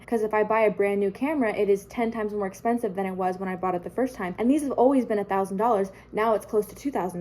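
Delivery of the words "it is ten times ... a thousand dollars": ordinary talk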